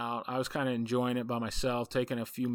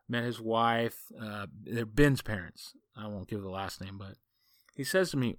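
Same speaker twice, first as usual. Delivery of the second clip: normal talking voice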